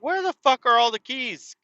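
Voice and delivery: high, incredulous outburst over missing keys; exasperated outburst. Retold or imitated. Driver finding the keys gone, high incredulous outburst. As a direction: shoot the question up high in disbelief.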